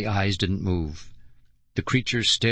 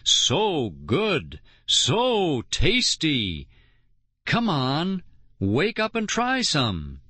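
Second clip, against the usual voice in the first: exaggerated tempting; 5 semitones higher, much slower than usual. A narrator's reading. Tempting snowman with food.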